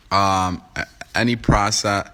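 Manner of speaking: ordinary talk, nothing marked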